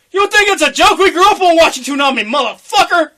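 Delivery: high, loud furious yell; angry shout. Retold or imitated. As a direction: yell at the boss in outrage. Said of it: "he was like".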